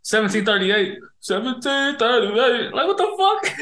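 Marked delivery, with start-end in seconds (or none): 1.2-3.6 mock disbelief. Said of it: "You over here".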